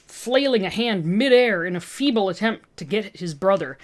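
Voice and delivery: usual speaking voice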